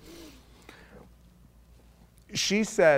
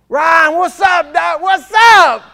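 much higher, much louder hammed-up slow southern shout; 14 semitones higher, 15 dB louder, slower than usual. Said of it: "talking like this".

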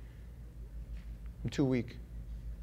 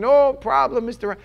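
hotel clerk; 9 semitones higher, 6 dB louder than usual. Retold or imitated. Front desk clerk brushes him off.

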